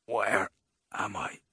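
halting croak, fading away. A narrator's reading. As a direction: croak it out in weak pieces.

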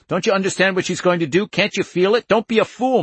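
fast, high, pleading shout; urgent shouting. A narrator's reading. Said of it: "he shouted at Al".

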